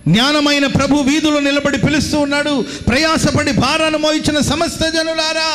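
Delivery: high, sustained preacher's cry; impassioned preaching. Acted. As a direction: call out to the crowd as Wisdom pleading. Preacher voices Wisdom calling in streets. (ఎత్తైన స్వరంతో ఆవేశపూరిత ప్రసంగం)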